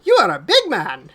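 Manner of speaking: put-on high, loud little kid voice; child staring up in wonder; awed child. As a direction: gape up at the big man. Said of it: "and goes".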